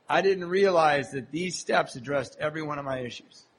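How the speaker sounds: ordinary talk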